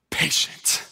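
whispered after a sigh, unvoiced; reluctant whispered child answer. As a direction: breathe it out like a resigned child. Daughter answers, sighs first.

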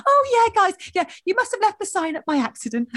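high, breezy fake-innocent tone; sweetly passive aggressive. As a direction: play dumb, sweetly pointed. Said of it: "she's just like".

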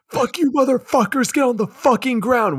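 high, loud screamed threat; squeaky kid yelling orders; screaming kid mobster. Retold or imitated. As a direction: shriek it like a tiny gangster. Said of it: "and scream".

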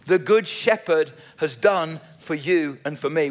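normal talking voice

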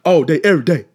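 cocky loud boast; defiant declaration. Retold or imitated. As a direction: shout it out proudly. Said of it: "I'm like".

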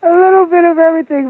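high, quick laughing echo. Real, not acted. Guest laughing as she echoes the host, high and quick. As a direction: tosses the phrase back through a laugh.

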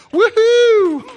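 loud, high cheering woohoo; joyful whoop. Real, not acted. Preacher cheering to the congregation, loud joyful whoop. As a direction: whoop it out in celebration. Celebrating the answer.

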